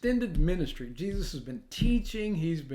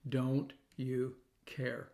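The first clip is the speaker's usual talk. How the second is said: "don't you care?" slowed right down and softer, each word weighted; stressed, slowed question; 4 semitones lower, 6 dB quieter, much slower than usual. Shown as a demonstration. Preacher underlining the disciples' words, slow stressed question. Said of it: "we should underline those words".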